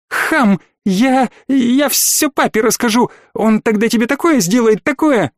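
high, stammering outraged threat; tearful threat. Acted. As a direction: sputter like a spoiled child. Threatens in tears.

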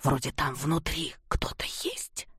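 unvoiced fearful whisper; frightened whisper. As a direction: whisper it in dread. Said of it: "опасливо уставился".

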